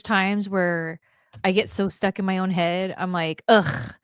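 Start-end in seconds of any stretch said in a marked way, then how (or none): 3.5-3.9 mildly exaggerated groan of a complaint; mock reluctant groaning. Guest quoting herself before a dog walk, groaning reluctance. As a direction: groan it like a dreaded chore. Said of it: "it's such a chore".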